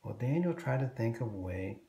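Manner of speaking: ordinary delivery, nothing marked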